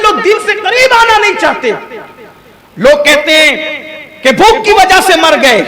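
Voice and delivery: high, loud fervent sermon voice; impassioned preacher pleading with the congregation; fiery preaching. Acted. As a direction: drive it home to the crowd with rising passion. Preaching at full force.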